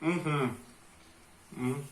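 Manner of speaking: muffled words around a bite; mouth full. Real, not acted. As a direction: still chewing, quiet and muffled. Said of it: "Falar de boca cheia é feio". (de boca cheia, mastigando)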